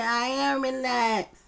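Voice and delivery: a mild mock drunk slur, words dragged out slowly; imitating slurred drunk speech. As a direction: lightly act out an intoxicated woman. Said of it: "dragging her words".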